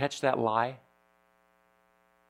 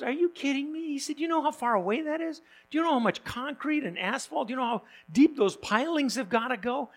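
much higher, incredulous genie voice; exasperated genie; 11 semitones higher than usual. Retold or imitated. Genie objects to building a bridge to Hawaii, high and incredulous. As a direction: play the genie scoffing at a silly wish.

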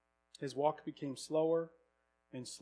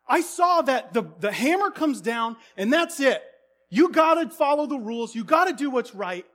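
much higher and louder, insistent; heated outburst; 11 semitones higher, 12 dB louder than usual. Retold or imitated. Middle brother protesting to his older brother, heated outburst. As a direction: drive home the rules with rising heat.

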